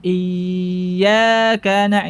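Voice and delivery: "iyyaka na-" slow with the ya drawn out; lengthened ya. Shown as a demonstration. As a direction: stretch the ya of "iyyaka" too long. ("iyyaka" ya dipanjangkan)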